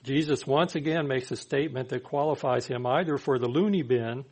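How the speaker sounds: ordinary delivery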